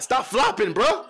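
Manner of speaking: loud and high, calling out a flop; yelling at the screen. Real, not acted. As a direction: shout it at the TV like the ref can hear. Yelling at a flopping player.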